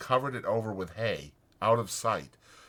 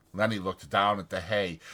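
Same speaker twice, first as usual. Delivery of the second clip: usual speaking voice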